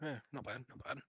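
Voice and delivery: soft, grudging muttered praise; grudging half orc. Acted. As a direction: shrug it off as not bad. Half orc gives a clap.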